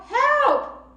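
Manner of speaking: high, loud shout for help; yell. Shown as a demonstration. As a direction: call for help at full voice. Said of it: "Everybody yell".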